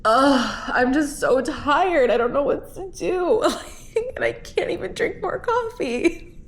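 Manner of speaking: high, slow, weepy laugh-crying; crying meltdown. Real, not acted. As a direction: sob it out, half laughing at herself. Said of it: "I'm literally having a meltdown".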